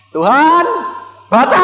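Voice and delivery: high, loud call to God; mock prayer cry. Preacher imitating his kneeling plea, high and loud. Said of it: "Saya begitu niru".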